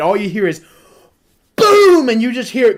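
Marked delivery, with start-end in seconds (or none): 1.6-2.1 high, explosive shouted boom; loud grenade blast. Retold or imitated. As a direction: imitate a grenade going off, very loud. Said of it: "as loud as a real grenade".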